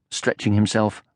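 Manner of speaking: usual speaking voice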